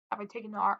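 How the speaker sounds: soft, low-volume question to herself; musing question. Real, not acted. Woman recalling her train rides, quiet musing question. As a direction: ask it under your breath, thinking back. Asks herself, then answers.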